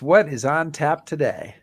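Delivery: ordinary talk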